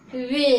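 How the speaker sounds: "वे" drawn out long and loud; long e vowel. Shown as a demonstration. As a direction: hold the e in "वे". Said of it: "लंबा करके". ("वे" को लंबा करके)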